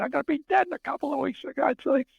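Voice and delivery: fast, high retold protest; acted-out indignant comeback; high-pitched mock retort. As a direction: rattle it off high, imitating a feisty friend. Acting out old friend's retort.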